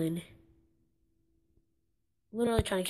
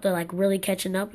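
normal talking voice

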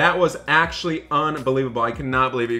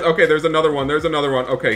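usual speaking voice

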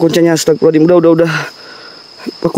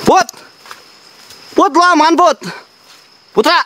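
very high, urgent questioning call; pitched-up worried shout-out; alarmed calling; 11 semitones higher than usual. Real, not acted. Calling to friend who screamed.

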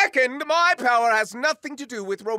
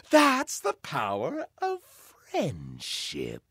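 sinister; 8 semitones lower, 4 dB quieter, slower than usual. Acted. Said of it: "said the word friendship as though it were a poison".

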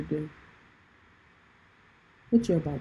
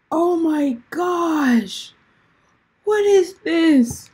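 mock shock; 9 semitones higher, 7 dB louder, much slower than usual. Real, not acted. Sudden shock at the screen.

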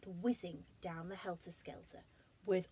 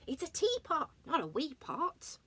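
robot voice; 8 semitones higher, 5 dB louder than usual. Acted. Robot scolding.

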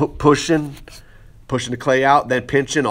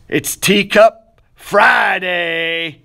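drawn-out shout, words stretched very slowly; shouted sign-off; 5 semitones higher, 4 dB louder, much slower than usual. Real, not acted. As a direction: bellow the show name to close it out. Show sign-off to viewers.

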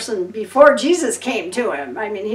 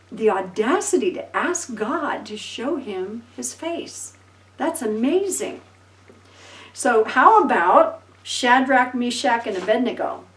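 usual speaking voice

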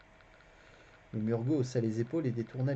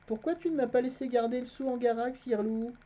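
put-on a boy's voice, pitched higher; the narrator voicing the boy Garion, higher; boy lying innocently to a stranger, higher voice; young boy Garion; 13 semitones higher, 4 dB louder than usual. A narrator's reading.

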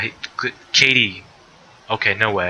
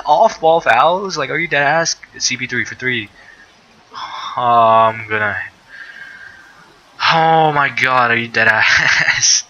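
exasperated disbelief; 3 semitones higher, 3 dB louder than usual. Real, not acted. Frustrated at foul call.

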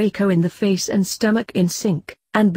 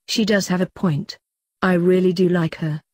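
plain reading voice

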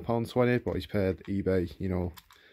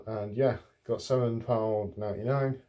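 usual speaking voice

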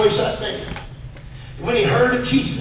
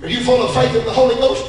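ordinary talk

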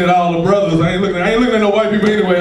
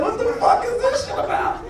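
high-pitched, softer incredulous question; mock squeaky bafflement; acted bewilderment; 13 semitones higher, 5 dB quieter than usual. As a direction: react in baffled disbelief, voice shooting up. Acts out shock at roof scene.

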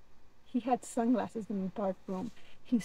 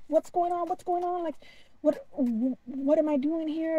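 high-pitched, urgent questions; retold panic; 6 semitones higher, 4 dB louder than usual. Abductee questioning the alien during the checkup, panicked. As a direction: act out bewildered freaking out, stumbling over words. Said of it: "I'm still freaking out".

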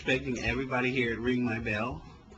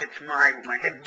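normal talking voice

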